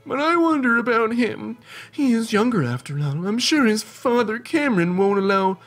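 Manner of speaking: put-on high, quavering old granny; sweet granny sending grandchild off; old lady voice. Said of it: "That's better. I like it".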